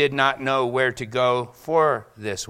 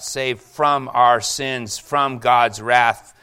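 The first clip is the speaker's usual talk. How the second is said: slow and louder, every word weighted; slow emphatic preaching; 5 dB louder, much slower than usual. Acted. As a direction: drive each word home slowly.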